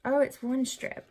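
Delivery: ordinary talk, nothing marked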